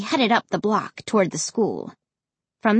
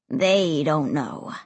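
low, slow, sly answer; 6 semitones lower, slower than usual. A narrator's reading. Ray admitting parents don't know, sly. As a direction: let it out low and slowly.

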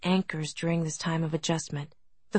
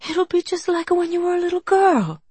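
higher and louder, full of hope; light up, coax her with hope; hopeful and eager; 11 semitones higher, 6 dB louder than usual. A narrator's reading. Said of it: "his eyes shining with hope".

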